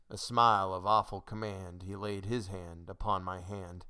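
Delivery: ordinary delivery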